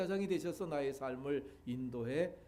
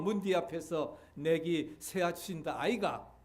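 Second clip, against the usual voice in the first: lively Gyeongsang dialect reading of the psalm; 2 semitones higher than usual. Acted. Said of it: "경상도 버전을 가지고서 한번 여러분들에게 읽어드리겠습니다". (경상도 사투리로 신나게 읽는 시편)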